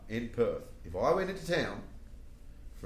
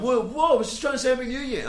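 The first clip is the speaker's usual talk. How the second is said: high, loud, quick mock protest; protesting innocence; 13 semitones higher, 8 dB louder, faster than usual. Woody defending himself to his angry friends, flustered protest. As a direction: plead innocence in a high rush.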